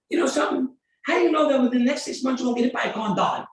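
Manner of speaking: loud, fast, high-pitched retold outburst; retold yell. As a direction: fire it at the caller, irritated. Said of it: "the way I yelled at him".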